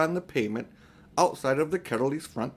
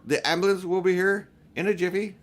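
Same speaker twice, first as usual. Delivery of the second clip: reassuring bystander; 6 semitones higher, 3 dB louder than usual. A narrator's reading.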